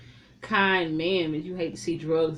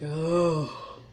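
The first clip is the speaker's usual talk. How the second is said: disgusted groan; 3 semitones lower, 5 dB quieter, much slower than usual. Retold or imitated. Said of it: "And I'm like".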